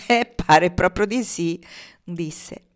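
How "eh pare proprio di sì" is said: loud, drawn-out chuckled agreement; laughing. A narrator's reading. Pocket conceding to Marnie, still laughing. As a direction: agree slowly through a big laugh. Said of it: "Anche Pocket stava ancora ridendo".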